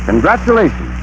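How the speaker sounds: ordinary talk, nothing marked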